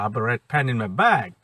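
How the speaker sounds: "bag" stressed with a falling tune, slow; in my bag, not elsewhere. Shown as a demonstration. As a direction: hit "bag" at the end of "I have a red pen in my bag". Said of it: "আপনার ব্যাগে আছে".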